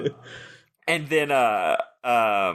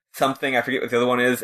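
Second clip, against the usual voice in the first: usual speaking voice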